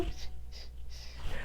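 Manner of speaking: soft breathy shh, shh; quiet whispered shushing. Pretend. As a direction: hush like a mommy calming babies. Mommies on the bus go shh.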